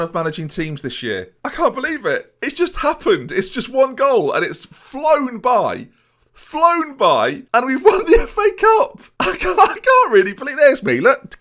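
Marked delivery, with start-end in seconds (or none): 1.4-11.4 high, loud, slowing cheer; ecstatic disbelief. Real, not acted. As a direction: savour it, almost crying with joy. Still celebrating the win.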